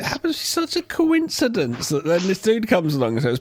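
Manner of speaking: usual speaking voice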